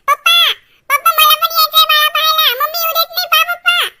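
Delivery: high, loud appeal to Papa; loud complaining wail. Acted. Child tattling to Papa about mother, loud whine.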